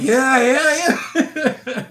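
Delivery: laughing happy yeah. Retold or imitated. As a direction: mimic his delighted laugh. Man laughing about home food.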